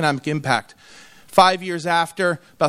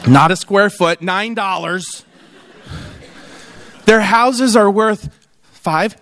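ordinary talk

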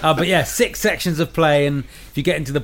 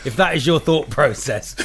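ordinary delivery

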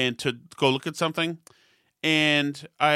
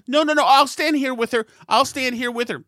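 higher, louder insistent woman; insistent busybody; 8 semitones higher, 5 dB louder than usual. Retold or imitated. Stranger insisting to the father she will stay, higher and louder. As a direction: plant herself beside the child and insist. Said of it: "She, she said".

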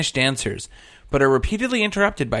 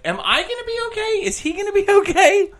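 much higher, mock-panicked questions; half-joking alarm at the sweating barber; panicked inner voice; 12 semitones higher, 4 dB louder than usual. Customer fearing barber will drop dead, mock panic. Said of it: "And I'm like".

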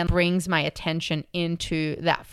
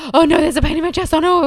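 higher, louder, fast mock panic; panicked; 9 semitones higher, 6 dB louder, faster than usual. Scared flyer noticing chest pain, mock panic. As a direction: rattle it off in a fearful rush. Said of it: "I'll just be like".

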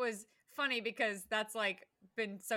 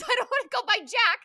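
loud whiny mock voice; 12 semitones higher, 10 dB louder than usual. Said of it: "So he says".

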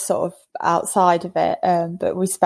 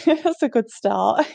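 laughing; 6 semitones higher than usual. Real, not acted. Laughing recalling a good first date.

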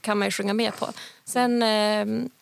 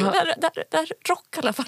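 higher, laughing mock protest; 6 semitones higher, faster than usual. To the quiz crowd, a laughing mock protest. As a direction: insist it still counts as rock, half laughing. Own group protesting in retelling.

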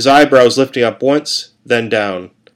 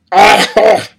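high choked ah through a tube; gurgled cry; 10 semitones higher, 6 dB louder than usual. A narrator's reading. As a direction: gag out a cry around a tube in the mouth. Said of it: "I gurgled through the tube in my mouth".

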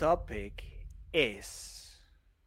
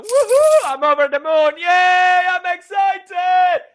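much higher, much louder shouting; explosive excitement; 13 semitones higher, 12 dB louder, slower than usual. Real, not acted. Co-host exploding into a loud, high, overjoyed shout. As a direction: burst out screaming with joy. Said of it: "explodes into excitement".

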